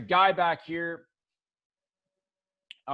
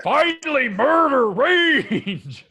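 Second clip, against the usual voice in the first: drawn-out louder bellow; slow, louder war cry; axe yells; 9 semitones higher, 7 dB louder, slower than usual. Acted. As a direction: roar it like a bloodthirsty axe. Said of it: "also yells with you".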